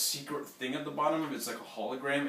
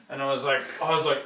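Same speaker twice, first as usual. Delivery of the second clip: ordinary delivery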